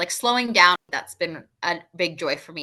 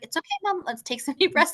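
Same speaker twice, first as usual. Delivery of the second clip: daughter's voice; 11 semitones higher than usual. Retold or imitated. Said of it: "she was just like".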